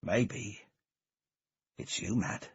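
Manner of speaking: slow menacing drawl; drawn out, low. A narrator's reading. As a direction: stretch each word slowly, low and soft. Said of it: "drawing out the words".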